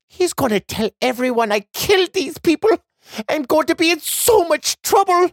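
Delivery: put-on fast, high, fretting girl; distressed. A narrator's reading. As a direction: panicky young girl. Girl despairs after Billy flees.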